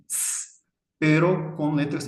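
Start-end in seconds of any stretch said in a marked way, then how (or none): 0.1-0.6 S as a long voiceless hiss; sibilant. Shown as a demonstration. Teacher demonstrating the shared sibilant, S hissed. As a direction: hiss S alone, softly, without any vowel. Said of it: "la misma pronunciación sibilante".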